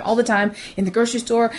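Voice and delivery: ordinary delivery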